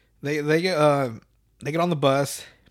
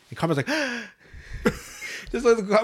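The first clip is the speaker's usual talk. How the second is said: gasp; 7 semitones higher than usual. Retold or imitated. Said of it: "watching Carmen gasp".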